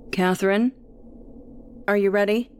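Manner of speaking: a father calling up from below, lightly voiced; faint distant call of a dad; echoing from downstairs. A narrator's reading. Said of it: "Dad's voice echoes from downstairs".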